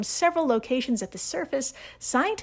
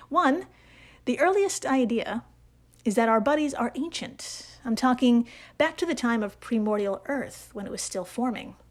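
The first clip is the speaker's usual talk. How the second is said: ordinary delivery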